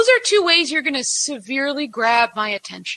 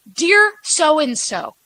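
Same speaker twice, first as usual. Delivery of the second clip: slow dictation; 4 dB louder, much slower than usual. Shown as a demonstration. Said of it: "dictating email formula".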